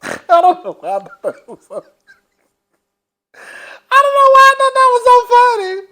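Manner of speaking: can't stop laughing while saying it; laughing hard. Real, not acted. Cracking up at the scene.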